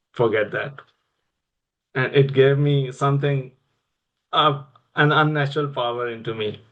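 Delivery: ordinary talk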